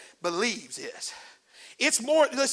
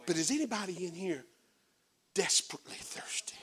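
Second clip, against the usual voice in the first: hushed question; 6 semitones lower, 13 dB quieter than usual. Acted. Preacher drops voice to ask congregation.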